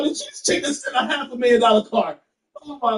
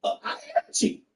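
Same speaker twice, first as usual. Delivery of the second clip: whispered joke; 8 dB quieter than usual. Pretend. Joking aside about being cheap.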